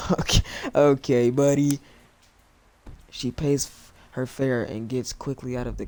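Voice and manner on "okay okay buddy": laughing sarcasm. Real, not acted.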